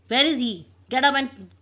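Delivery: loud, high angry demand; shouting. Retold or imitated. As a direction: yell at the cook to get up. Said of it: "he started shouting to the Bond".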